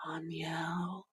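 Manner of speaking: soft unvoiced whisper of the name. Real, not acted. Angel name whispered after plain take.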